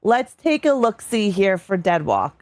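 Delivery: usual speaking voice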